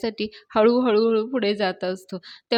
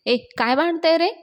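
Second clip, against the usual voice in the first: higher-pitched, mildly raised scolding; scolding yell; 7 semitones higher, 3 dB louder than usual. A narrator's reading. Avani scolding two bickering men, mild high-pitched yell. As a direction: tell them off with a rising pitch. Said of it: "परत त्यांच्यावर परत ओरडते".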